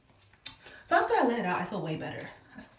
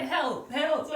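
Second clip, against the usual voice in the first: high, louder mock cry for help; playful, high-pitched retold yell; 8 semitones higher, 3 dB louder than usual. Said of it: "So I started yelling".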